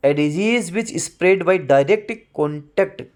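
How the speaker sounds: slow, deliberate reading of a definition; slow dictation. Shown as a demonstration. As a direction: read it slowly so students can write it down. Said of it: "dictates definition".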